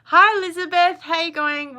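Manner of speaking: welcoming a live viewer, excited; cheery hello. Real, not acted. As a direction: call out a happy hello. Greeting a viewer.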